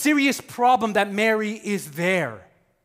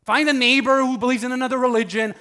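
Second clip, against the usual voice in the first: normal talking voice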